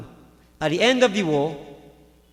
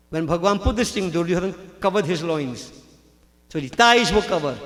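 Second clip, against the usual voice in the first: ordinary delivery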